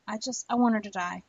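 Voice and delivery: ordinary delivery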